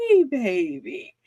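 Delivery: slow, sugary hello from the lady, faintly put-on; drawn-out syrupy greeting hiding a grudge; sweetly pleasant greeting. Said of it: "the tone would be just so pleasant".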